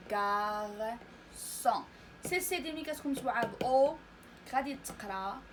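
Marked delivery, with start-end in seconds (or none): 0.1-1.8 "غارسون" very slow and lower, split in two; model pronunciation. Shown as a demonstration. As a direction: hold gar, pause, then son with an s in "غارسون". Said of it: "الكلمة الأخيرة". (نطق "غارسون" مقطّعًا ببطء شديد)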